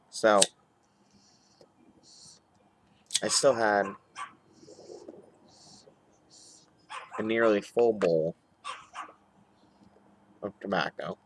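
talking around pipe stem; pipe in mouth. Real, not acted. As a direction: lips gripping pipe. Seen 1: Pipe stem in mouth while lighting and talking; briefly removed near 4.2.

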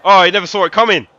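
loud, fast, high gleeful shout; excited yell. Real, not acted. Gamer shouting at the screen as his player beats a defender, loud and gleeful. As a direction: crow over the beaten defender. Celebrating a skill move in-game.

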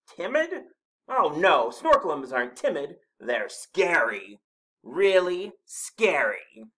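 high question, then loud, slowing boast; snorted denial. A narrator's reading. Snorklum denying timidity to Rabbit, loud blustering denial. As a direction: insist grandly, stretching each 'scary'. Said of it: "snorted the Snorklum".

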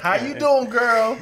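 high, loud sassy greeting; high girly greeting. Pretend. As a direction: sing it high like a diva. Playful put-on greeting.

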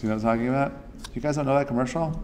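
usual speaking voice

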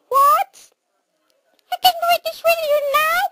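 very high, quick mouse chatter. Acted. Mouse asking about watching the movie, quick mouse squeak. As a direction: squeak it fast like a tiny mouse. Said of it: "no more mouse voice".

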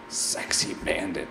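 soft whispered name read to himself; whispered aside. Real, not acted. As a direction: whisper it slyly.

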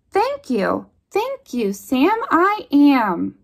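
plain reading voice

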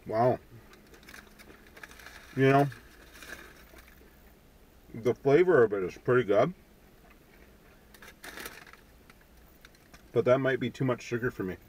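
talking between bites; chewing while talking. Real, not acted. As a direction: mouth partly full of food. Seen 1: Man talks holding pretzel after bites; mouth looks busy chewing.

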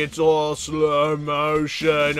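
very slow, dragged-out words; slowed like laggy video; slow motion. Pretend. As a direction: mimic the game lagging in slow motion. Mimics lag slow motion.

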